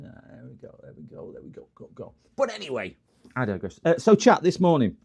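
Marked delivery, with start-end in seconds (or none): 0.0-2.1 trailing whisper. Real, not acted. Fading whisper. 3.8-4.9 loud refocus. Real, not acted. Loud return to topic.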